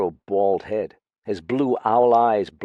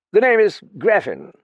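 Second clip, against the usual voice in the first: put-on loud, raised pompous drunk announcing himself; loud tipsy self-introduction; drunken announcement; 12 semitones higher, 8 dB louder than usual. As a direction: proclaim your name grandly, a little sloshed. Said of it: "he announced".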